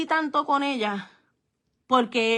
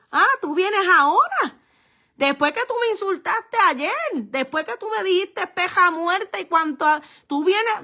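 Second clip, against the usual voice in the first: indignant retort; 5 semitones higher than usual. Pretend. Mother rebuffing the neighbor who insulted her, indignant. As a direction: throw the insults back in their face. How mom could have retorted.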